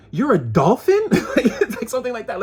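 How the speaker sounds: high, loud laughing disbelief. Real, not acted. Reader laughing at the dolphin image, real laugh. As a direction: crack up at the idea.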